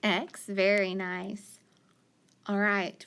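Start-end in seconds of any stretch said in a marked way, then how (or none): none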